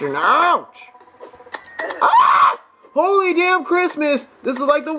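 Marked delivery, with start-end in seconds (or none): 2.0-2.6 high, loud startled scream; a real yelp of pain and shock; real sudden scream. Real, not acted. Gamer hit by a monster, high loud startled scream.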